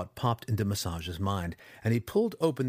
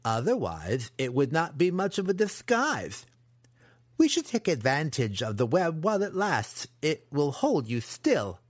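higher-pitched apprentice, reasoning coolly; menacing young apprentice; 4 semitones higher than usual. Acted. Apprentice explains the plan to his trapped victim, menacing. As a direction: explain the cruel plan as a cocky student.

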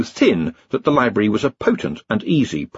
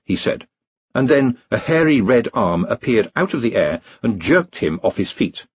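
ordinary delivery

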